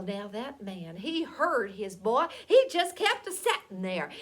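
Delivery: ordinary delivery, nothing marked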